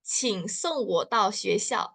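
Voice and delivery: "Qing song wo dao xue xiao." said slowly, word by word; slow Mandarin. Shown as a demonstration. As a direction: model the phrase slowly for students. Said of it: "ngomongnya gimana?". (pelafalan Mandarin pelan)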